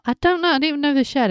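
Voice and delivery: high, rising bewildered shrug; baffled. Real, not acted. Puzzling over who took whose car, high and bewildered. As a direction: sound totally lost. Confused aside about the car.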